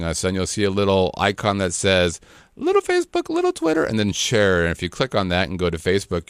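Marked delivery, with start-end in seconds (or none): 2.6-3.8 put-on squeaky, much higher baby-talk labels; high cutesy voice. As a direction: say them like a tiny cartoon voice. Reading icon labels in cute voice.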